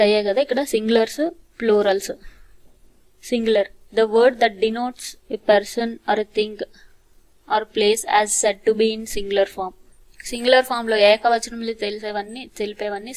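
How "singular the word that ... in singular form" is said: very slow, careful reading; slow dictation. Shown as a demonstration. Teacher slowly dictating the singular rule to students. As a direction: read the grammar rule slowly.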